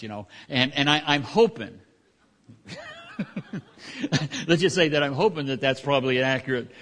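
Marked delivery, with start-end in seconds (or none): 2.5-3.6 sudden high-pitched laugh breaking out; high burst of laughter. Real, not acted. Preacher laughs at his own hope about the droppings, a high burst of laughter. 4.5-6.7 laughing while speaking. Real, not acted. Laughing through hopeful remark.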